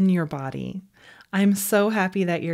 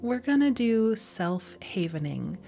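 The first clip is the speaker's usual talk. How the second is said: guided meditation; 4 dB quieter than usual. Real, not acted. Meditation guide leading listeners through self-havening, soft guided-meditation voice. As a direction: ease listeners into calm, unhurried and quieter.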